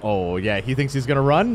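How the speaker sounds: usual speaking voice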